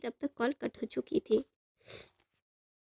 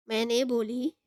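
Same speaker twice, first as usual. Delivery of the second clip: plain reading voice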